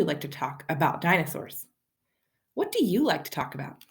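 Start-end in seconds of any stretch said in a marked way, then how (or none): none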